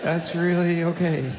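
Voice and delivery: lightly voiced, falsely encouraging; mock sweet praise. Dad praising baby's diaper, sugary and patient. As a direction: humor the baby with fake delight. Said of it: "you just go".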